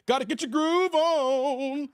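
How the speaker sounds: high, drawn-out catchphrase; Martin. Acted. Streamer quoting Martin to get going, slow high catchphrase. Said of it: "Like Martin would say".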